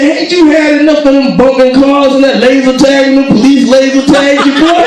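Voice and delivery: loud, high-pitched rant from a fed-up father; exasperated dad scolding. Retold or imitated. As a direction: scold your grown son at high pitch. Dad scolding his grown son.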